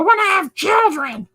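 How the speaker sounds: squeaky cat. Retold or imitated. Cat tells owner it wants children, squeaky. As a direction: wail it like a tiny cartoon cat. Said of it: "he does voices".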